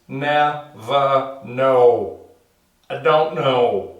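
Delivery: very slow, separated syllables. Acted. Man lectures the village on three syllables, slow and emphatic. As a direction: spell out NEVER KNOW beat by beat. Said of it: "three syllables, bitches".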